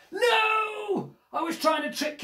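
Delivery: high shouted no in alarm; panicked shout. Acted. Reader shouts at the child not to turn the last page. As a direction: yell it out in panic. Character panics at trickery failing.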